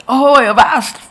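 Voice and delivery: bellowed high and loud ahoy; angry captain. Retold or imitated. Captain Ahab bursting onto the deck, angry bellow. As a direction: roar it like a furious sea captain. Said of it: "and he's angry".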